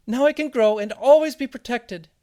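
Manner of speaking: very high, loud tiny seed voice; exclaiming seed. A narrator's reading. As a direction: exclaim it loudly in a squeaky little voice. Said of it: "the seed exclaimed".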